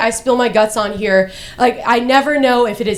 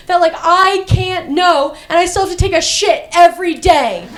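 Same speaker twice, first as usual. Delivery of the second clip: high-pitched, worked-up comic tirade; exasperated rant; 7 semitones higher than usual. Acted. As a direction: rant in mounting frustration, voice pushed high. Frustrated comic rant about being misunderstood.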